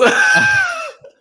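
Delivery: bursting out in a loud laugh; big sudden laugh, real. Real, not acted. Laughs after admitting sarcasm.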